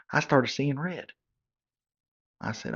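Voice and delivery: normal talking voice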